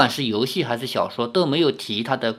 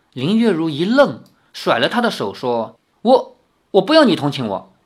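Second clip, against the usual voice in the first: raised, lively storybook reading; story narration; 5 semitones higher, 4 dB louder than usual. A narrator's reading. Narrator reading the quarrel of three young friends aloud. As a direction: read the novel scene aloud with lively voices. Reads novel aloud with voices.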